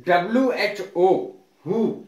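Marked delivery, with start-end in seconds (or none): 1.7-1.9 हु begun on an H sound; silent W. Shown as a demonstration. Teacher modelling who for students, हु with silent W. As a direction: say हु with no W at the start. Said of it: "इसको हु कहते हैं".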